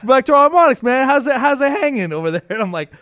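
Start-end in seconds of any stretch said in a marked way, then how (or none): 0.0-0.8 higher, louder, chummy New York guy voice; imitating the rep's voice. Salesman calling a customer, higher louder guy voice. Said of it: "You do that voice perfectly". 0.8-2.4 higher, louder, chummy New York guy voice; imitating the rep's voice. Salesman calling a customer, higher louder guy voice. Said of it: "You do that voice perfectly".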